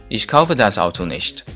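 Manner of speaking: ordinary talk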